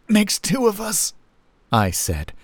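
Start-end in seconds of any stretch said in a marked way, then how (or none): 0.1-1.1 high, faraway reply; distant voice. A narrator's reading. Narrator near fainting, answering Harriet, high distant voice. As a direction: answer as if drifting away. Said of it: "my own voice distant".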